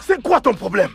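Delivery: a husband shouting his wife out of the house, furious; angry rant. Acted. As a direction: lash out at her, building to a shout.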